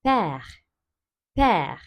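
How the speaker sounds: "Père" with a wide open è, drawn out; open, lengthened E. Shown as a demonstration. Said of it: "E'yi açıyoruz artık". ("père" açık E ile, biraz uzatarak)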